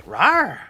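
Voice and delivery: loud, playful dinosaur roar. Pretend. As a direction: roar out like a little saurian.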